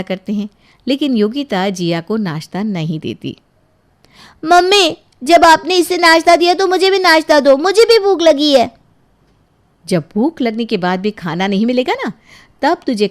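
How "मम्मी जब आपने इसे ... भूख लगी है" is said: put-on high, whiny child complaining to grandma; a young girl pleading her case, a bit higher; sulky little girl. Girl complains to grandma about her mother, whiny child voice.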